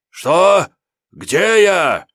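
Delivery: high panicked demon shriek; terrified wail. Acted. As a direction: wail in sheer terror. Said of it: "завопил он на демоническом". (испуганный вопль)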